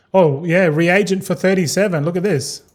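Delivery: ordinary talk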